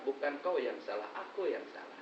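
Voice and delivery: soft, gentle admission of fault; tender apology. Retold or imitated. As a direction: softly take the blame yourself. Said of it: "kata Nabi".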